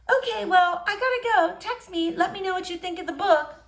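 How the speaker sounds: put-on shy girl greeting a boy she likes.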